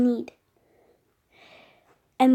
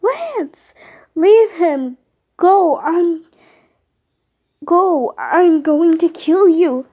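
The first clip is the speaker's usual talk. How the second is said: loud, high shouted orders and threats; desperate shouting; 5 semitones higher, 9 dB louder, slower than usual. Acted. Fleeing as Lance is taken, loud desperate shouts. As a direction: yell over the chaos. Lance left behind, fury.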